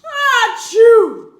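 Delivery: huge, loud, high wind-up sneeze; big sneeze. Acted. Bear sneezing from the pepper, a huge loud achoo. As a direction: build the ah and blast the achoo. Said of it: "He blows and he sneezes".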